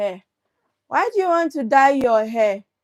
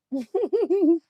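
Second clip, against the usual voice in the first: laugh; 6 semitones higher, 3 dB quieter than usual. Real, not acted. Laughs after Igbo greeting.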